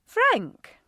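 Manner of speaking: sharp, high and loud call of his name; startled to see her son in the doorway; surprised. A narrator's reading. Mother spots her son at the door, surprised. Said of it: "his mother said with some surprise".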